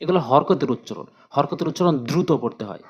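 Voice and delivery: ordinary talk, nothing marked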